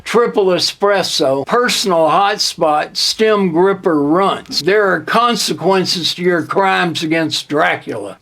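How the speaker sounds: ordinary talk, nothing marked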